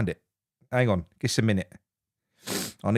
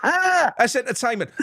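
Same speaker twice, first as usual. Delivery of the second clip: laughing, high and slow; 10 semitones higher, slower than usual. Real, not acted. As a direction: laugh the words out with delight. Laughing excited repeat.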